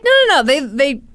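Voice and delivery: put-on high squeaky French accent. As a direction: protest in a hammy French accent. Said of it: "you've been doing a French accent".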